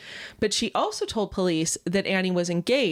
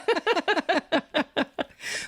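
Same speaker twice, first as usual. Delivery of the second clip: laugh; 7 semitones higher than usual. Real, not acted.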